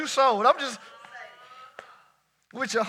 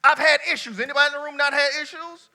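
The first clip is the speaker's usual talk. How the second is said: preaching; 5 semitones higher, 6 dB louder than usual. Real, not acted. Preaching at full force.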